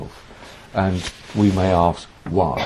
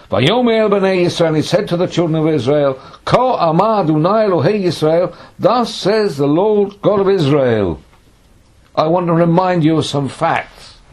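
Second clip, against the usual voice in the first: high, loud scriptural declamation; proclaimed prophecy; 8 semitones higher, 5 dB louder than usual. Acted. Samuel addressing the children of Israel, proclaiming. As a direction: announce it like a prophet before the people.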